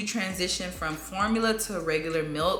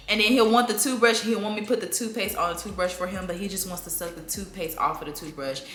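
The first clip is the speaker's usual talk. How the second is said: ordinary talk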